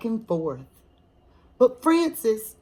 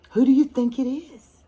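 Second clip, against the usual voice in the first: ordinary delivery, nothing marked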